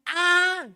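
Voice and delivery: very loud, high cry of pain; long, high pained yell; anguished cry. Acted. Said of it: "De angustia, de dolor".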